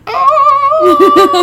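very high, loud squeal of delight; thrilled at show tickets, squealing; mock delighted squeal. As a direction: squeal like you just got tickets. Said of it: "I'm like".

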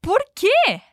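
high, loud shocked why; shocked question. Shown as a demonstration. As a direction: ask it in disbelief. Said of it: "Como você perguntaria". (pergunta chocada e aguda)

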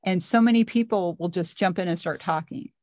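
ordinary delivery

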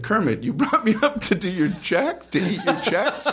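high laughing retort. Retold or imitated. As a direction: laugh through the line. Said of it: "And I, I said".

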